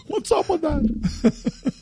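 high, slow, laughing Seinfeld bit; Seinfeld impression. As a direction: stretch it out like a Seinfeld riff. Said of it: "That was, uh, Seinfeld".

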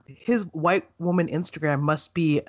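normal talking voice

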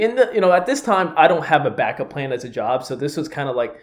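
ordinary delivery

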